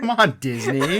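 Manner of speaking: loud, laughing plea; mock exasperation. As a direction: scold them playfully through a laugh. Playful scolding of the film studio.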